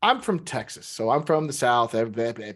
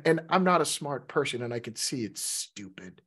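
ordinary delivery

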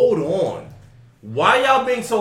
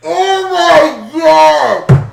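loud, high, laughing shock; shocked shout; 10 semitones higher, 7 dB louder, much slower than usual. Real, not acted. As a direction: yell in disbelief while laughing. Reacting to the gross story.

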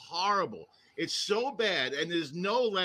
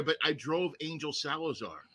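usual speaking voice

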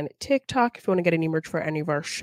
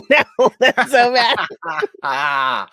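laughing, slowly; 5 semitones higher, 8 dB louder, much slower than usual. Real, not acted. Laughing at herself.

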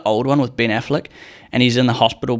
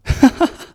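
burst of high laugh; 3 dB louder than usual. Real, not acted. Laughs at own story.

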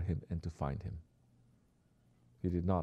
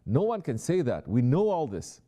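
emphatic; 7 semitones higher, 6 dB louder than usual. Real, not acted. Preacher's emphatic rebuttal.

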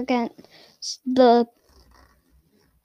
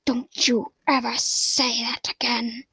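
slow, hushed threat; menacing whisper; 4 dB quieter, slower than usual. Acted. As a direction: whisper a stern warning. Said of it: "He said".

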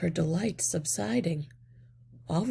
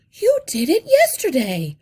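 raised voice, exclaiming the discovery; cried out; 8 semitones higher, 13 dB louder than usual. A narrator's reading. Mother mouse cries out to the rats in surprise. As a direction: cry it out, suddenly realizing. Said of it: "cried Missus Frisbee".

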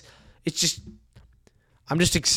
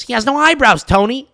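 Carmela; 11 semitones higher, 8 dB louder than usual. Retold or imitated. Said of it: "And Carmela goes".

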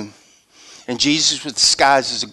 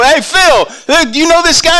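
much higher, loud retold hail; excited calling out; 13 semitones higher, 9 dB louder than usual. Pastor calls Phil about the guy, excited hail. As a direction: hail a friend eagerly, loud and high. Acts out excited phone call to friend.

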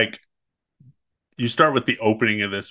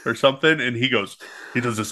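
normal talking voice

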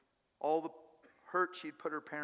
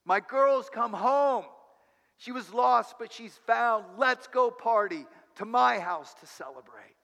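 joyful cry; 6 semitones higher, 9 dB louder than usual. Acted. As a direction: cry it out to the whole station. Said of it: "he cries out".

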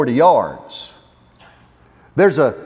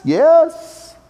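normal talking voice

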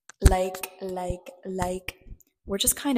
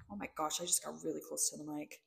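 normal talking voice

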